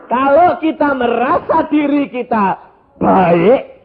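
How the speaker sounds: loud, slow proclaiming with a laugh; full-force preaching. Acted. Pastor to congregation, loud slow preaching.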